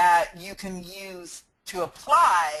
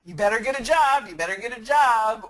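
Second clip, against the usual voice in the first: raised, fast, insistent warning; quick, louder mock scolding; retold nagging warning; 4 semitones higher, 6 dB louder, faster than usual. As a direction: push it like a nagging parent. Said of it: "to be told".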